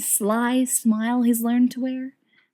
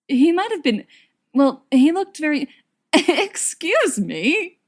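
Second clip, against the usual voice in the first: breaking into helpless giggles; laughing helplessly; 7 semitones higher than usual. Acted. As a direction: lose it to laughter mid-sentence. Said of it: "he's starting to laugh, helplessly".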